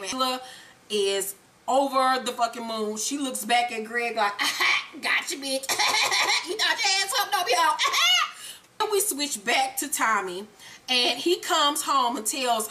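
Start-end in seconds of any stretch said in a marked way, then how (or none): 4.4-7.8 high, laughing gotcha taunt; mock gloating cackle. Angela looks back at Greg, over the moon, gloating and cackling. 7.8-8.5 very high, short triumphant cackle; mock gloating cackle. Angela is over the moon at Greg, gloating cackle.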